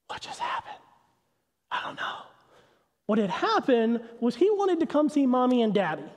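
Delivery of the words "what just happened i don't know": put-on a dazed toddler's thought in a high whisper; stunned toddler. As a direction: whisper it like a baffled two-year-old. Toddler stunned after hitting door.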